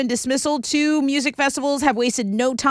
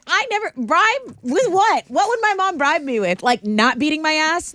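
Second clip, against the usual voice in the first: incredulous; 6 semitones higher than usual. Real, not acted. Mocking idea of mom bribing.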